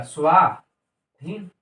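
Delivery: स्वाधीन drawn out syllable by syllable; slow reveal. Shown as a demonstration. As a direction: stretch स्वाधीन slowly for the class. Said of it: "teacher reveals joined word". (स्वाधीन को धीरे-धीरे खींचकर बोलें)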